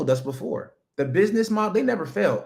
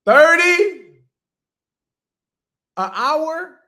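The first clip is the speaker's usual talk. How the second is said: high, loud amazed squeal at the pay; hammed-up shock at thirty an hour; mock disbelief; 11 semitones higher, 9 dB louder, much slower than usual. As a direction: play it like it's a fortune.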